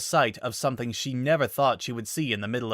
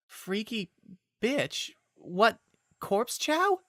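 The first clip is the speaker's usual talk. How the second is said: much higher, slow puzzled echo; baffled repeating; 12 semitones higher, much slower than usual. Acted. Dazed Twilight repeating Rainbow's words, baffled. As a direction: echo the strange words in confusion. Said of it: "Twilight repeated".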